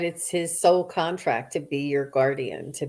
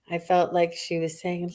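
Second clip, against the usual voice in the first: ordinary talk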